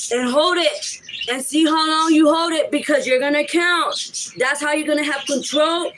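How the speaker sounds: usual speaking voice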